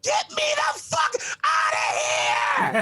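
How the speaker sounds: slow, high, strained scream to be let out. Retold or imitated. Comic on mushrooms voices a woman's sweat-soaked crotch, screaming slowly. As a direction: howl it, dragged out and desperate. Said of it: "her pussy's like".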